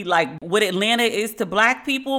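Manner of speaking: ordinary delivery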